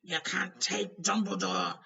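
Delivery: loud, slow desperate yell. A narrator's reading. Hagrid defending Dumbledore, a loud slow yell. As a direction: shout to stop them. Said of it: "yelled Hagrid".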